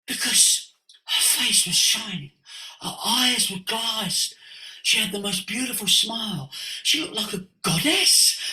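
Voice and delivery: high-pitched, smitten gushing, imitated; awestruck raving. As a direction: gush as the friend, voice lifted in wonder. Acts friend smitten by transformed woman.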